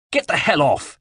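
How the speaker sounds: a mild yell of alarm. A narrator's reading. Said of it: "yelled Checo's driver, alarmed".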